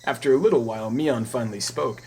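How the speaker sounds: usual speaking voice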